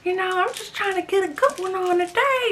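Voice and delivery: high, playful mock of Special K; guy voice imitation. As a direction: act out his smooth talk lightly mocked. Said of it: "And then he's like".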